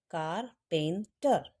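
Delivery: Carpenter said slowly, kar-pen-ter, in parts; in chunks. Shown as a demonstration. Teacher helps students memorise the spelling: slow chunked carpenter. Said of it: "टुकड़े में याद करना बताती हूँ".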